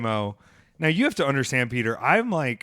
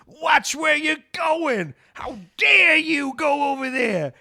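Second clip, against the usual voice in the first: much higher, loud, slow mock outrage; hammed-up road rage from the back seat; mock screaming; 13 semitones higher, 5 dB louder, much slower than usual. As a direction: yell at other drivers like an offended diva. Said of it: "I just screamed at people".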